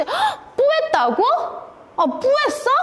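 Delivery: high-pitched mock-shocked questioning; shocked disbelief. Wife catching husband's admission about the smoke, shocked. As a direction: gasp out an incredulous question, acting it up.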